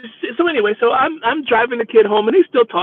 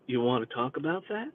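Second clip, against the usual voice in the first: very soft, low-pitched offer; gentle question; 6 semitones lower, 13 dB quieter than usual. Retold or imitated. Driver to crying passenger, gentle and hushed. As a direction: ask it softly, voice dropped low.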